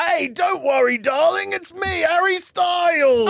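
high, exaggerated British accent; hammed-up British actor, stretched and high-pitched. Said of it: "That's what he sounds like when he's acting".

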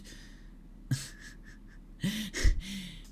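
high, breathless silent laugh; breathy laugh. Real, not acted. Host laughing before telling the best game line, breathy laugh. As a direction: burst into a wheezy laugh.

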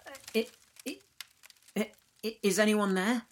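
high, stammering, scared to ask; nervous stutter. A narrator's reading. Jordan nervously asking the darkness on the recorder, high stutter. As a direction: call timidly into the dark. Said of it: "with a little stutter in his voice".

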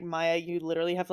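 ordinary talk

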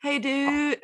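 high, tentative stab at British; attempted British accent. Shown as a demonstration. Friend testing how to say it British, high attempted accent. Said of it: "How do you do that British?".

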